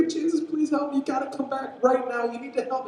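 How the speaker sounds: high, whiny pleading, half-sobbed; mock blubbering. Jairus begging Jesus to save his daughter, mock blubbering plea. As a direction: play a desperate father begging, hammed-up. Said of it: "like, kinda blubbering".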